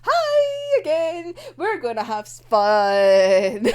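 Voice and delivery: slow, drawn-out sing-song menace; a high, teasing threat, sung out slowly; creepy Nikolai. Retold or imitated. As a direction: stretch each word like a playful villain. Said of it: "Nikolai just appears and goes like".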